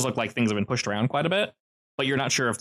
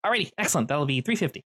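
put-on high, bright young man's voice, much higher; pitched-up friendly counter guy; cheery young pizza worker; 4 semitones higher than usual. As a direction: greet the customer eagerly.

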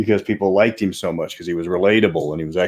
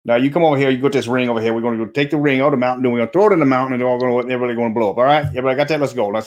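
put-on Cajun accent; 4 semitones higher, much faster than usual. Game master voicing Elrond to his party, Cajun accent. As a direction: rattle off the quest plan in a Cajun accent. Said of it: "making all my elves Cajun".

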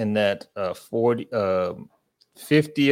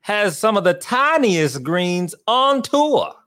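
put-on Southern accent; 3 semitones higher than usual. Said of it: "You do sound like you're about ready to fight in the Civil War".

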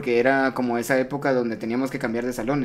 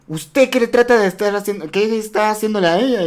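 higher, accusing teacher voice; stern teacher; 7 semitones higher, 4 dB louder than usual. Retold or imitated. Vice principal accusing the boy, stern scolding. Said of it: "Y me llama a mí".

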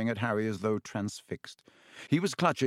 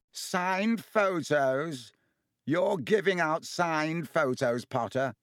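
scathing; 6 semitones higher, slower than usual. A narrator's reading. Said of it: "Loud and scathing".

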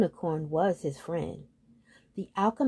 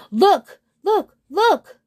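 loud, very high, separate cries; excited exclaiming; 11 semitones higher, 9 dB louder, much slower than usual. A narrator's reading. Smaller hill spotting moons in the sky, excited. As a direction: pipe up louder and higher in amazement.

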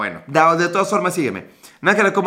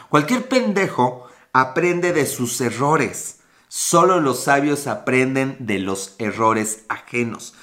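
slow recitation; 3 semitones lower, slower than usual. Shown as a demonstration. Reciting the saying to his Periscope viewers, slowly. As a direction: lay out the proverb slowly, like a lesson.

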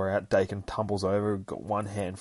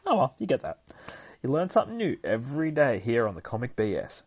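ordinary delivery

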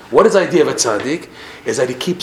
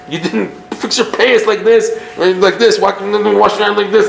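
higher-pitched fussing parent, half laughing; mock nagging in a raised pitch; nitpicking parent; 7 semitones higher, 3 dB louder than usual. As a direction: fuss over every tiny detail. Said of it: "the parent is like".

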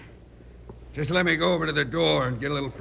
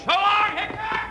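taunting farewell shout; slower than usual. Acted. Outlaw leaping from the train, shouting goodbye at Hickok. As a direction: fling it back as you jump off the moving train.

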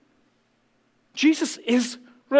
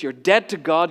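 usual speaking voice

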